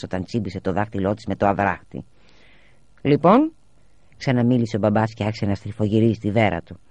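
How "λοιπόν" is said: expectant prompt. A narrator's reading. Father demands the notebooks.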